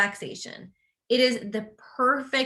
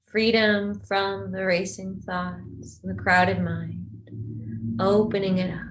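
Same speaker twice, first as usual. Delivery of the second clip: guided meditation. Real, not acted. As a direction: guide them gently into rest, unhurried and even.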